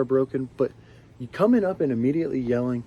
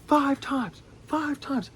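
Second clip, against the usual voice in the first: high, squawked imitation of a yeller; mock screaming; 13 semitones higher, 4 dB quieter, much slower than usual. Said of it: "Why are you screaming in my face?".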